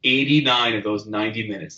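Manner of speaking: normal talking voice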